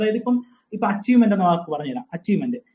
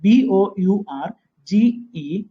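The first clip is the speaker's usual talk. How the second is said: each letter said slowly and separately; teacher spelling out the word slowly; much slower than usual. Shown as a demonstration. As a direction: dictate letter by letter. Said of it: "പഠിക്കേണ്ടത് ബൗർജിയസ്".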